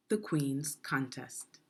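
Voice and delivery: usual speaking voice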